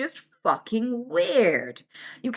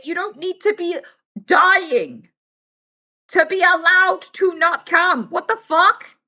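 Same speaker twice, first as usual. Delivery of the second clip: louder and higher, slow; indignant; 6 semitones higher, 5 dB louder, slower than usual. Real, not acted. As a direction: push it out with outrage. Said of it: "indignant rant".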